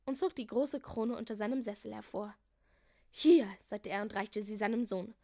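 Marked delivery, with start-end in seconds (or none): 3.1-3.5 higher, loud exclamation; loud hand-over. A narrator's reading. King handing his son the crown, loud and emphatic. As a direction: call it out brightly and firmly.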